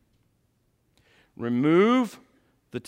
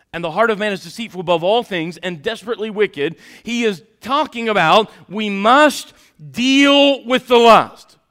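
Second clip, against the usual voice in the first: full-force preaching; 7 semitones higher, 12 dB louder than usual. Real, not acted.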